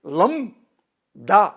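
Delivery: a sharp outraged shout on one syllable; sudden loud burst. Real, not acted. Angry man quoting the insult thrown at his family, shouting. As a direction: blurt the word out in a loud burst.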